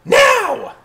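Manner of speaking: high, loud squeaky command; piercing little voice calling out; squeaky shout. Acted. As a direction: shriek the order like a little creature. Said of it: "hear someone go".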